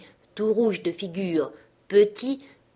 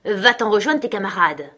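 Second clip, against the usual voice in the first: loud, forceful dismissal; angry cry; 3 semitones higher, 6 dB louder than usual. A narrator's reading. Dignitary red with anger at the boy, shouting. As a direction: send the boy packing, voice raised. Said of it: "cria-t-il".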